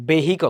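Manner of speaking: ভেহিকল said slowly, "be-HI-kol", h sounded; common mispronunciation. Shown as a demonstration. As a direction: say ভেহিকল in three slow syllables with an audible h. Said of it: "অনেকে বলে কি".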